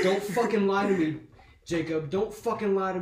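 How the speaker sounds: imitating an angry mom's yell. Mom confronting her lying son, angry.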